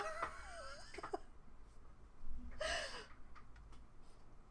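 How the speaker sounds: breathless high-pitched laughter; cracking up, out of breath. Real, not acted. Streamer laughing at his own joke, high cackle.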